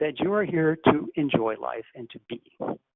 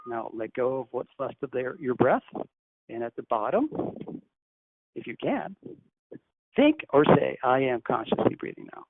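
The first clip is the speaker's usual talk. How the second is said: guided breathing meditation; 3 semitones lower than usual. Acted. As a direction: ease the listeners gently into each breath. Leads a breathing exercise.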